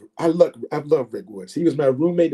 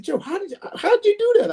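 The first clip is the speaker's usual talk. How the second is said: very high-pitched, baffled question; mock astonishment. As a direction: imitate a beaten opponent, utterly bewildered. Retelling beaten opponent's amazement.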